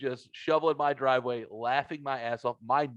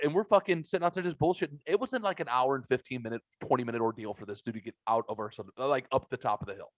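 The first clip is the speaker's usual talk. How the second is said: ordinary delivery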